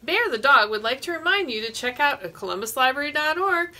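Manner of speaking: ordinary talk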